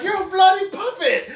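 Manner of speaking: a high, laughing mock of Spike. Spike jeers at Angel the puppet, high and laughing. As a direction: crack up while jeering like Spike. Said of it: "Spike is like".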